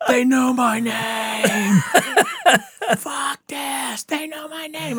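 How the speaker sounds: high, slow, drawn-out panicked cry; hammed-up fright of a man who's been recognized; panicked yell. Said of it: "he's yelling to go".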